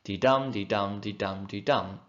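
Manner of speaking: teacher beating out iambic tetrameter; iambic rhythm demo. Shown as a demonstration. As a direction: chant the iambic beat. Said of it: "which go unstressed, stressed".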